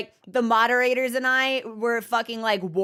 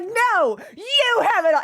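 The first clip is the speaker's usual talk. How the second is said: higher, louder mock protest; playful shout back; 10 semitones higher, 6 dB louder than usual. Friends shout the compliment back at her, playfully. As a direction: throw the compliment back loudly. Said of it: "And they're like".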